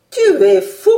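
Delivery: high, loud disbelieving retort; scoffing retort. Acted. The scientist scoffs at Fred, a high loud retort. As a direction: shout down his claim.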